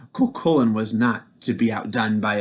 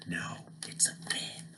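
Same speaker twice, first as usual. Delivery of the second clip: whisper; 7 semitones lower, 18 dB quieter than usual. Real, not acted. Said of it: "aside to listeners".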